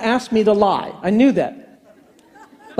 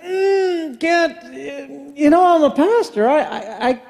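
higher, whiny, drawn-out mock cry; mock whine; 4 semitones higher, much slower than usual. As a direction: whimper it in mock distress. Mock whining pastor.

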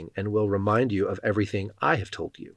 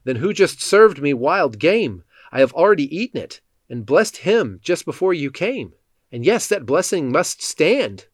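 higher, agitated narrated alarm; louder, pitched-up reading of a shaken father; trembling shock; 6 semitones higher, 4 dB louder than usual. A narrator's reading. Isaac learns he blessed the wrong son, trembling. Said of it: "began to tremble uncontrollably and said".

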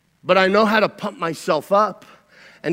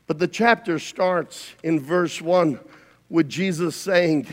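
ordinary talk

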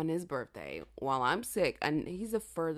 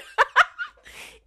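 big squealing laugh; loud high laugh; 15 dB louder than usual. Real, not acted.

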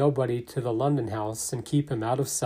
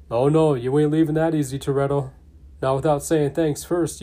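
loud and fast, barging in on the radio; booming Hobbs; 3 semitones higher, 6 dB louder than usual. Acted. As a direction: cut in like Hobbs taking over. Hobbs bursts in over comms.

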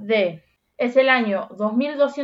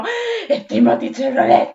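mock fangirl cry, a bit louder; excited squeal; 4 dB louder than usual. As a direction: gush at a trailer of a crush. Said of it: "las dos fue como". (grito emocionado de fan, en broma)

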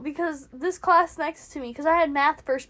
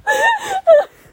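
laughing apology; 9 semitones higher, 5 dB louder than usual. Real, not acted. Apologizing while still laughing.

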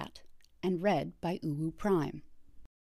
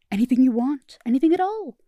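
much higher and louder, delighted; brightened; 7 semitones higher, 11 dB louder than usual. Acted. Therapist thrilled Starscream agreed, bright excited voice. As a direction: burst with eager joy. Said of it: "The therapist brightened".

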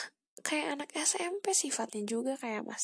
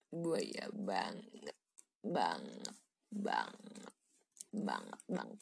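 sinking deep voice; 7 dB quieter, much slower than usual. Pretend. Repeats, dropping voice.

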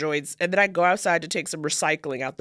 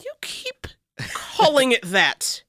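slow, higher, emphatic correcting; exasperated correction; 7 semitones higher, slower than usual. Real, not acted. Correcting co-host's nickname for a true-crime film, exasperated. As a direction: spell it out, drawn out and insistent.

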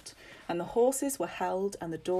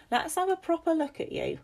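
put-on high-pitched old mistress, demanding; high, commanding inspection of a servant; imperious old lady; 12 semitones higher, 4 dB louder than usual. Lady Agnes having a look at Maisie, imperious.

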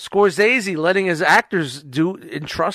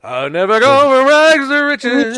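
movie quote voice; 8 semitones higher, 7 dB louder than usual. Retold or imitated. Quoting Goodfellas line.

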